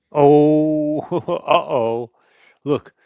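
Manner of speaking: loud, startled, with a laugh; alarmed uh-oh. Acted. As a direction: react loudly as things go wrong. Time travel goes wrong.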